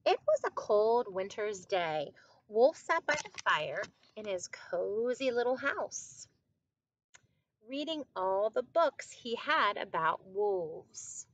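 storybook read-aloud with drawn-out phrase endings; story reading to children. A narrator's reading. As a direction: read it out clearly for young listeners. Reads a picture book to children.

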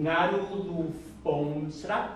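slow, syllable-by-syllable teaching phrase; slow model. Shown as a demonstration. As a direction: draw each word out for learners to try.

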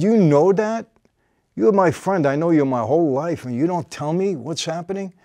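angry outburst. Retold or imitated. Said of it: "now I got even more mad".

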